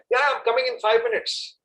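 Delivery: put-on high, breezy kid's answer; child fobbing off parents. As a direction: toss it off like a kid mid-game.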